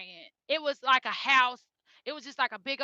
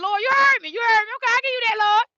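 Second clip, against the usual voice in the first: retold tearful thanks to the Lord, higher and louder; crying prayer; 10 semitones higher, 8 dB louder, faster than usual. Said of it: "So I was just crying".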